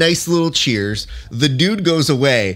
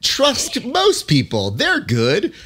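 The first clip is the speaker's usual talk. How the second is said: higher, sugary mock-cheerful yay; mock sappy cheer; 9 semitones higher, slower than usual. Critic mocking the fighter as a naive, sugary friend-to-all. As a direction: lampoon a naive everyone-is-friends attitude. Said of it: "you sitting there being like".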